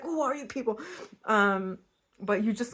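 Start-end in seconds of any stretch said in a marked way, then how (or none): 0.0-0.8 higher, pointed mock demand; mock aggressive. Reader mocking her blunt question to viewers, higher mock aggressive. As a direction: playfully imitate your own blunt question. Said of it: "I'm sorry I sound so aggressive".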